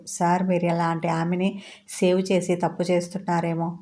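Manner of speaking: ordinary delivery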